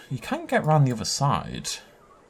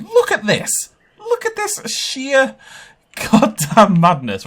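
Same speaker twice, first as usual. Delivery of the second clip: high, loud, drawn-out marvelling; gobsmacked and raised way up; over-the-top amazement; 13 semitones higher, 6 dB louder, slower than usual. Real, not acted. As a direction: stretch it out in high-pitched disbelief. Amazed at huge build.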